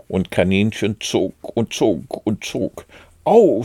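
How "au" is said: sudden high, loud "ow"; pained cry. A narrator's reading. Pooh to Rabbit as he is pulled, crying out in pain. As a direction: yelp it out, pitched up. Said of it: "schrie Puh".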